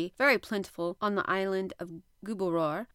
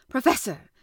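loud, higher-pitched appeal; hungry harpooner's loud hail; eager call; 5 semitones higher, 9 dB louder than usual. A narrator's reading. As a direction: call out to the professor with urgent craving. Ned calls eagerly to professor.

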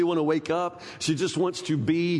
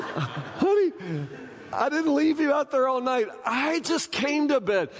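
laughing protest; 6 semitones higher than usual. Retold or imitated. Husband defending himself laughing.

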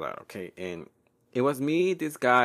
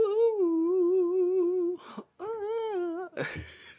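long high warbling note; silly sung wail. Pretend. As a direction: belt a high silly note, half crying. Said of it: "playing around with my fucking voice".

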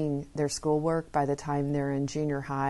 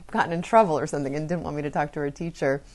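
ordinary talk

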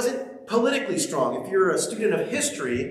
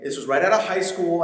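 usual speaking voice

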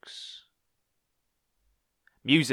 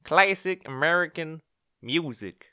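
put-on awful American accent; 10 semitones higher, 7 dB louder than usual. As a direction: put on a bad American accent to open the show. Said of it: "That was an awful accent".

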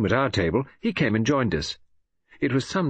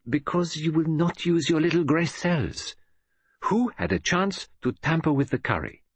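a hint of a fussy foreign detective, higher; lightly voiced Poirot; 5 semitones higher than usual. A narrator's reading. Poirot lightly scolding Hastings for not thinking. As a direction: chide him gently, as a know-all detective.

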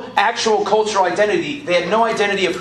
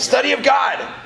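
pitched up, approving repeat of the answer; higher, eager affirmation; excited echo; 8 semitones higher than usual. Real, not acted. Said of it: "congregation answer echoed".

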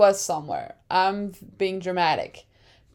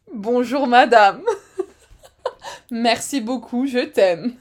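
giggling through mock French phrases; playful laughing French. Host joking about being French, laughing. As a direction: say it in French, cracking up.

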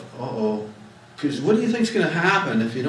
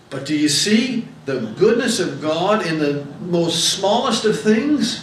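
rising preacher's climax; 6 semitones higher, 7 dB louder than usual. Real, not acted.

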